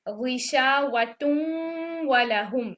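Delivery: slow recitation, वलहुम merged with ghunna; Quranic recitation demo. Shown as a demonstration. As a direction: recite गिशावतुम वलहुम slowly, nasalized merge. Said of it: "हम इदगाम करेंगे लेकिन बिगुन्ना".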